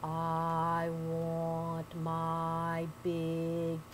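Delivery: low, very slow moaning ghost. Acted. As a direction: drag every word out, low and hollow. Ghost voice in haint tale.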